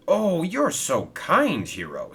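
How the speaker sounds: high, slow gushing thanks; a delighted little engine, slowly gushing; happy. A narrator's reading. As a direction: gush with delight. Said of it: "said Stephen happily".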